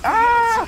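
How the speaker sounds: loud, very high scream. Pretend. Gamer startled by zombies, high scream. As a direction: scream out suddenly.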